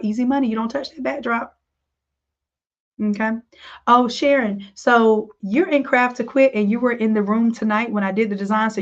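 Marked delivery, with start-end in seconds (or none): none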